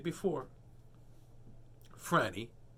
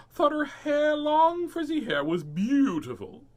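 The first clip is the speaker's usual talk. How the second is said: higher, slow and loud, savoring the words; slow singsong story read; dreamy storybook read; 13 semitones higher, 8 dB louder, slower than usual. A narrator's reading. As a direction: stretch it out like it's beautiful. Reading to child, dreamy stretch.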